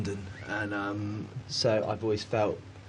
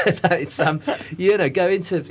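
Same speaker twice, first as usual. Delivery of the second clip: laughing; 9 semitones higher, 8 dB louder than usual. Real, not acted. Laughing about getting beaten.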